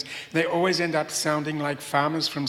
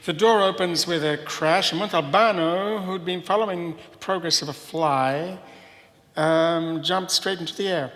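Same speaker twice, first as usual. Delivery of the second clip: reading a novel excerpt aloud, a bit louder; dramatic reading; 5 dB louder than usual. Acted. Translator reads an excerpt to the audience, lively reading. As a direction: read the scene out with some drama.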